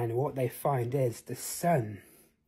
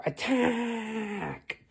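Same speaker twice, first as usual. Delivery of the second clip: rally them into the fight; battle cry; 8 semitones higher than usual. Acted. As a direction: heroes charge the dragon. Battle cry before charging.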